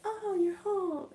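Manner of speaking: high, slow put-on welcome; chirpy aunt greeting. Aunt to kid coming home, high loud slow greeting. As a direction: greet in a loud, lifted, drawn-out voice.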